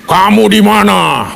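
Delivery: drawn-out, booming monster-like roll call; menacing dinosaur-like God. Retold or imitated. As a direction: stretch every vowel like a dinosaur stalking in. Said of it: "itu sama persis kayak dinosaurus masuk di Jurassic Park".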